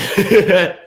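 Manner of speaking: sudden high-pitched laugh; high burst of laughter. Real, not acted. Big laugh at viewership joke.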